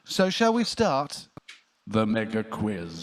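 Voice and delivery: slow, drawn-out game show opener; quiz host announcement. Acted. Host announcing the mega quiz to co-host, slow showman style.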